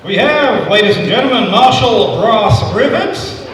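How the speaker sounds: announcer voice. Acted. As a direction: present him like a ring announcer. Announcing a contestant.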